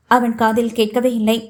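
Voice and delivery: usual speaking voice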